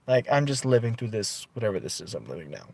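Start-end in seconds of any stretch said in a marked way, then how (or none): none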